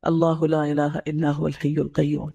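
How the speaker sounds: measured Quranic recitation. Shown as a demonstration. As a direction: recite the verse slowly and reverently. Teacher recites Ayat al-Kursi.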